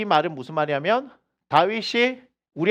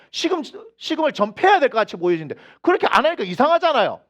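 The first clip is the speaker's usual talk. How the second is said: normal talking voice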